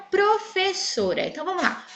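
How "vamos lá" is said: ordinary delivery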